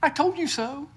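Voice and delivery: mock-smug told-you-so; joking gloat. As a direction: playfully mimic them gloating back. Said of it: "I said that in jest".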